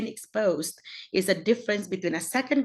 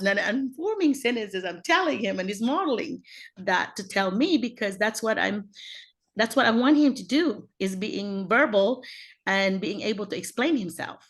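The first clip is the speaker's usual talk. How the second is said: ordinary talk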